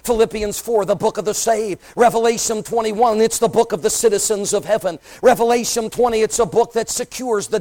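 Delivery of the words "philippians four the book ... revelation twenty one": ordinary delivery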